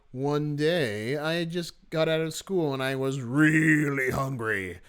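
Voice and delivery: slow read, "really" stretched out and stressed; emphasis on "really hungry". Shown as a demonstration. Teacher reading the formatted passage aloud, "really" heavily stretched. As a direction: draw out "really" long before "hungry". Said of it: "we wanna put more emphasis on really hungry".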